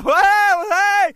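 high, loud imitated yells; mock bellowing; retold hollering. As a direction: holler to get attention, imitating him. Imitating man shouting unintelligible signals.

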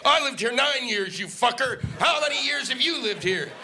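hammed-up road rage rant; angry shout. Acted angry driver quarrel.

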